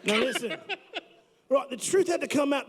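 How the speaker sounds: put-on hammy British-Australian accent; the truth, said like a bloke from Britain. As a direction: guest fakes an accent for the hosts. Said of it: "what's up with the accent".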